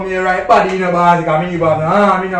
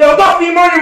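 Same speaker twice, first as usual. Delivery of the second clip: loud yell; 9 semitones higher, 5 dB louder, faster than usual. Acted. Urging the boss.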